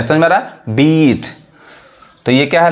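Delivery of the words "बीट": बीट with a long, stretched ee; long vowel. Shown as a demonstration. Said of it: "long sound है हमारा".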